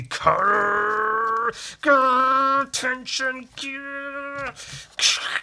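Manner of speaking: high, loud mock growl; hammed-up angry growls. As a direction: snarl at him. Voicing growling character.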